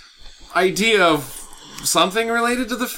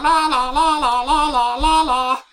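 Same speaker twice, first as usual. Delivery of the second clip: leprechaun singsong; 6 semitones higher than usual. Acted. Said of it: "I think that's the sound that leprechauns make".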